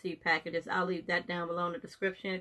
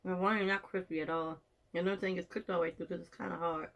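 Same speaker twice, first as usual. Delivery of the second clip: talking around food; chewing while talking; 4 dB quieter than usual. Real, not acted. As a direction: mouth partly full. Seen 1: Bit sprout at -7; jaw grimacing, chewing motions while talking.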